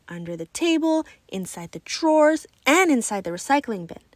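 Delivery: usual speaking voice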